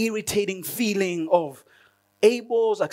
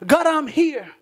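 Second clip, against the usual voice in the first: a raised plea, reaching up once more; loud cry to God; 8 semitones higher, 5 dB louder than usual. Pretend. Worshipper crying to God in prayer, loud and urgent.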